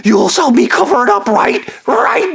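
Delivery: shrill, very high mocking voice with sobbing laughter; mocking Stu. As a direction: squeal out a cruel caricature, teetering between crying and laughing. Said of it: "I was making fun of Stu".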